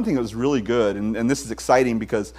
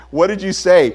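louder demand to repeat; loud asking again; 5 semitones higher, 8 dB louder than usual. Retold or imitated. A dad straining to hear his teenager, loud demand. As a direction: push it out as if half deaf. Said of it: "It's like".

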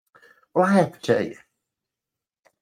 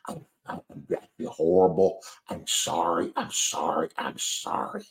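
hushed, faltering, low apology; retold flustered apology, voice shrinking away almost to nothing; mortified apology; 3 semitones lower, 6 dB quieter than usual. Rider apologizing to the lady he soaked, mortified.

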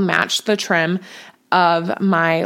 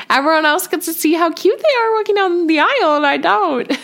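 mock whiny complaint; 9 semitones higher than usual. Real, not acted. Bride missing the flower girls' walk, high whine. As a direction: pout it out, playfully sulky. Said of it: "sad she couldn't see".

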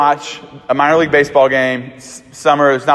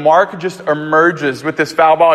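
ordinary delivery

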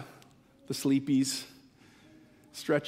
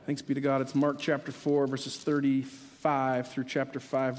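ordinary talk

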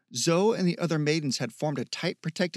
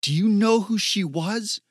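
higher and louder, grieving rage; furious question; 6 semitones higher, 6 dB louder than usual. Acted. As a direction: demand it through anger and grief. Zoe raging over dead girl.

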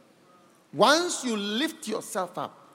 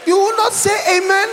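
calling out; 11 semitones higher, 8 dB louder than usual. Real, not acted. Preacher prodding congregation for amen.

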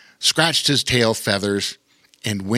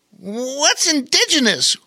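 put-on squeaky little-kid voice, much higher; eager curious child, pitched way up; high childlike Bobo Chico voice. A narrator's reading. Bobo Chico asking Pete eager questions, high childlike voice.

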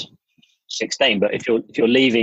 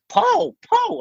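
high shrill-free falsetto calls of Paul; high mock woman calling Paul. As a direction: mimic a woman calling out across a crowd.

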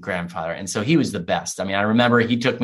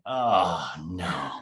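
lower, much softer, a small caught-out groan; sinking dread; 6 semitones lower, 7 dB quieter than usual. Retold or imitated. A boy realizing grandfather overheard his complaint, dreading. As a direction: realize you've been caught.

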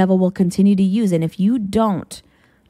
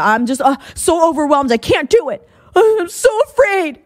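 higher, rising into a frightened cry on the last words; mock overwhelmed fear; 6 semitones higher than usual. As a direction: play someone at the end of their rope. Voicing an overwhelmed anxious person.

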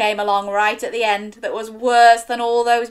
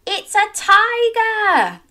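excited reveal; 12 semitones higher, 6 dB louder, slower than usual. Real, not acted. Quiz reveal to child.

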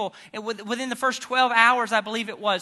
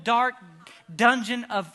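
normal talking voice

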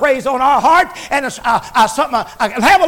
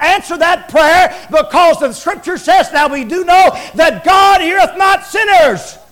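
preacher booming the verse; preaching scripture; 4 semitones higher than usual. Acted. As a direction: proclaim the verse.

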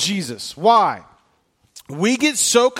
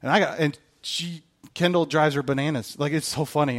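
ordinary talk, nothing marked

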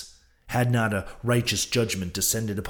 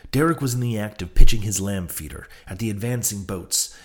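ordinary delivery, nothing marked

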